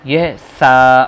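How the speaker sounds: سا said with tongue between teeth; lisped th sound. Shown as a demonstration. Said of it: "دانتوں کے بیچ میں جیب رکھ کر".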